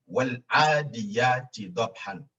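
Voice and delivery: slow, deliberate chant of the verse; slow recitation. Shown as a demonstration. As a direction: say it slowly for them to repeat. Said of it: "Just say".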